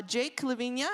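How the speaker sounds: usual speaking voice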